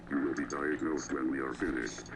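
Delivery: ordinary delivery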